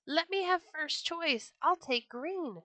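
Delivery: high, sharp parrot squawk; screeching parrot. A narrator's reading. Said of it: "screeched the parrot".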